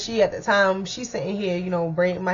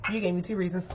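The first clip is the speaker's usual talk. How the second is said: quiet threat; 7 dB quieter than usual. Retold or imitated. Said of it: "So I'm like".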